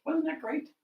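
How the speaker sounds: high little cat voice; lightly voiced self-satisfied cat; pleased cat.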